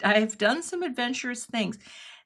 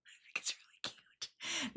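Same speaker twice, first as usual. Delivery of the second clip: whispered aside; 7 semitones higher, 17 dB quieter than usual. Real, not acted. Whispered aside over her work.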